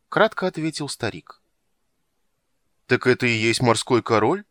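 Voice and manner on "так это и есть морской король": louder, stunned question; astonished. A narrator's reading. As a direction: gape in disbelief. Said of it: "разинул рот Чани".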